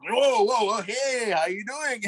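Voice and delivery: mock a star turning instantly welcoming, voice way up. Rock star suddenly greeting [name], imitated delight. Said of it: "all of a sudden his demeanor goes".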